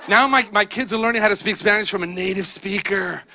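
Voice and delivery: ordinary delivery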